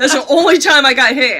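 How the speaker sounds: real laughter; laughing. Real, not acted. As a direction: keep laughing as you tell it. Laughing about being hit.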